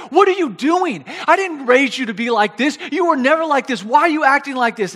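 loud, high-pitched mom scolding; acted indignant telling-off; berating. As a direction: lay into the kid like an exasperated mother. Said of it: "she starts berating the kid".

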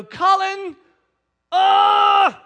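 high, loud cry of dismay; acted parental outburst; exasperated dad yell. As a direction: shout the child's name in horror. Dad sees the spilt applesauce.

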